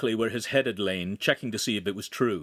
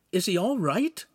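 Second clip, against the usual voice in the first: high-pitched anxious question; worried boy, pitched up; fearful; 10 semitones higher than usual. A narrator's reading. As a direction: ask with a touch of fear. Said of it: "Lincoln asked fearfully".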